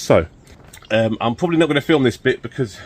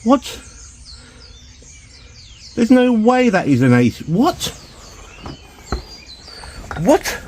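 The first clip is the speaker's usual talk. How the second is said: real disbelief; 11 semitones higher than usual. Real, not acted. Car sits too high.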